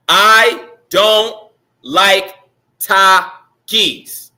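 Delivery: very slow, syllable by syllable; dramatic poem recitation. Acted. Announcer performing his own haiku for students, slow dramatic recitation. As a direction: declaim each syllable with long pauses.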